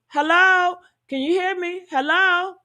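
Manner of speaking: high, questioning fake bad-line call; mock can't hear. Caller covering up with fake static, mock can't hear. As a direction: imitate someone faking a bad connection. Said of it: "he can't hear".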